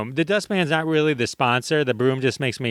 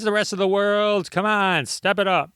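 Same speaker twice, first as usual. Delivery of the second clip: ordinary delivery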